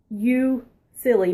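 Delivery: usual speaking voice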